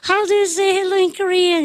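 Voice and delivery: high, slow sustained question; imitated singer asking a huge crowd. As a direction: hold it high and stretch it out. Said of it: "[name]가".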